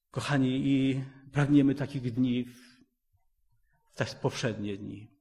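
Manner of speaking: ordinary talk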